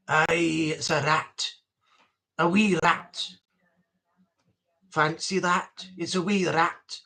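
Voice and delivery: put-on low Scottish old man; gruff low Scots voice; mock Scottish grumble; Scottish accent. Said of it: "when you say things in Scottish".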